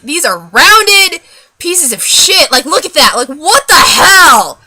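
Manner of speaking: loud, drawn-out outrage; exasperated rant. Real, not acted. Ranting to viewers about rounded eyebrows, exasperated. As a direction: stretch it out in loud disbelief.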